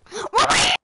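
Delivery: shrieking high and loud; high shout. Pretend. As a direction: scream the name way up high. Shouted Wario chant.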